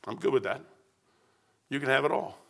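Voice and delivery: low, soft, easy acceptance; quiet surrender. Retold or imitated. Preacher answering God, quietly accepting. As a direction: give it all up softly.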